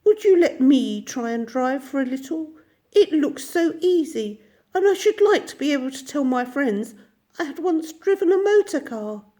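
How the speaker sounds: high, coaxing plea; wheedling washerwoman. A narrator's reading. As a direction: Toad sweet-talking as the washerwoman. Toad begging to drive.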